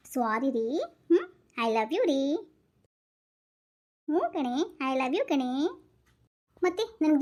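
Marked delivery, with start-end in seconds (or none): 0.1-5.5 sweet, making up; tender make-up. Acted. Couple making up after the fight, slow tender I love you.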